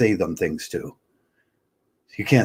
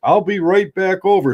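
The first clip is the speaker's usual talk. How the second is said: higher, louder mock simpering husband; 8 semitones higher, 5 dB louder than usual. Husband calling to his approaching wife, mocking fawning voice. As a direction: fawn over the wife, hammed-up. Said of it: "then they're like".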